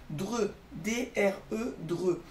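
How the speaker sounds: "D R E" letter by letter, then "dre"; spelled out. Shown as a demonstration. Teacher breaking down the second syllable for children, spelled out. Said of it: "attaquer la deuxième syllabe".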